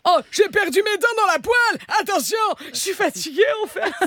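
put-on high, shaky old lady voice; old woman voice. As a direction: play a frail old woman. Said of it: "fais la vieille".

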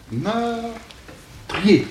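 "meurtrier" as a long held high "meur", a break, then "trier"; two drawn-apart syllables. Shown as a demonstration. Teacher demonstrating the old count to students, "meurtrier" slowly split in two syllables. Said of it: "deux syllabes".

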